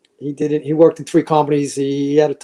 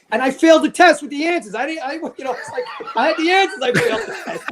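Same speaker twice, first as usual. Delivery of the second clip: rising disbelief; 10 semitones higher than usual. Real, not acted. Laughing disbelief at own story.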